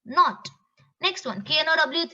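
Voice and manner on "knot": "Knot" pronounced not; silent K. Shown as a demonstration. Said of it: "The actual pronunciation".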